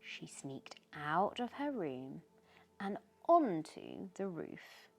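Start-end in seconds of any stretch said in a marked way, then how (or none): none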